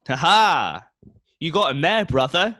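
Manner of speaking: loud, high laughing god voice; hearty booming god cheering on his brother. Acted. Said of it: "The voice of Zeus rings".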